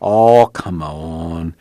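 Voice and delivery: low, drawn-out cajoling; slow, easygoing persuasion; coaxing. A narrator's reading. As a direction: draw it out slowly to talk him round. Tom coaxes the reluctant preacher.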